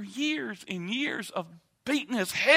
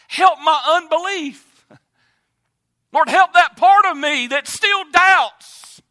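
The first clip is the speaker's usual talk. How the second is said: loud, slow pleading cry; pleading preacher; 8 semitones higher, 11 dB louder, slower than usual. Acted. Preacher crying the father's plea for help, slow and loud.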